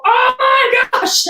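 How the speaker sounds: slow, high, loud mock gasp of dismay; imitating sudden dismay. Retelling how owners react after hurting their dog, mock alarm. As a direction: act out the startled owner. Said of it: "all of a sudden you go".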